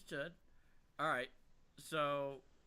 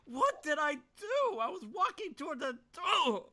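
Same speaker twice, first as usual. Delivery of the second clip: high, slow, loud bewildered protest; 11 semitones higher, 5 dB louder, slower than usual. Acted. [name] protests to the tackling attacker, bewildered. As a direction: plead confusion, loud and high. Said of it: "I'm just like".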